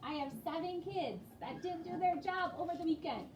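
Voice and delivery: ordinary talk, nothing marked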